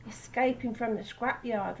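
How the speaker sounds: usual speaking voice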